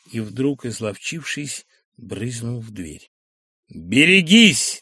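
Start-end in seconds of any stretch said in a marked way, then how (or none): 3.7-4.8 loud, slow drawn-out yell; warning yell. Acted. Bormenthal yelling after the fleeing Sharikov, loud warning. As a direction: yell a threat after him. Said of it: "барменталевский крик".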